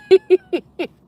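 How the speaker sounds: squealing laugh into "okay"; laughing burst. Real, not acted. Streamer cracking up at her own joke, laughing.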